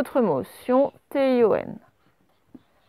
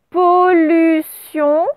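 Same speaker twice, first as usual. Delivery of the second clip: slow, syllable-by-syllable dictation of pollution; dictating word slowly; 3 semitones higher, 3 dB louder, much slower than usual. Shown as a demonstration. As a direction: draw out pollution for the class to write. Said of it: "il y a deux L dans pollution".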